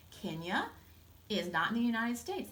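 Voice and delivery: plain reading voice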